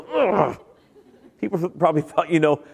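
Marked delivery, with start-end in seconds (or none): 0.0-0.6 higher, exasperated growl; frustrated groan. Retold or imitated. Preacher imitating himself walking down a sidewalk, frustrated groan. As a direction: mimic a groan at an unwanted thought. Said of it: "I'm walking down a sidewalk and I'll go".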